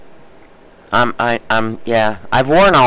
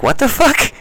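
shocked; 9 semitones higher than usual. Retold or imitated. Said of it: "I'm like".

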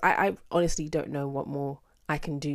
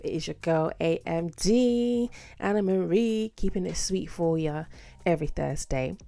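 ordinary talk

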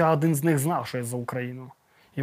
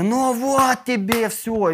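higher, slow gloating captor voice; 7 semitones higher, much slower than usual. Retold or imitated. Captors gloat over the photos they found on his phone. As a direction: drag it out, mocking and pleased.

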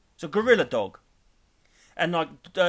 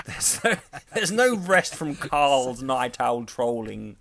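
posh voice; 3 dB louder, much slower than usual. Pretend. Posh bit about Carl.

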